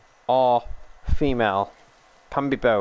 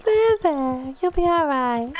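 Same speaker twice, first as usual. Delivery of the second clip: sweet lady soothing a pony; man doing a woman's voice; 11 semitones higher than usual. Pretend. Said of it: "With a lady voice".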